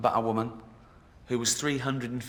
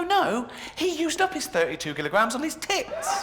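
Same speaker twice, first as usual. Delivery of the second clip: mock indignant retort; 14 semitones higher than usual. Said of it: "I wanna go".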